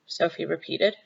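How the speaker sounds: usual speaking voice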